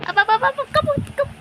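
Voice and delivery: shouted high, urging the climb; excited urging. Real, not acted. Climbing in the game, yelling at the character to move. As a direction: yell at the character to get up there.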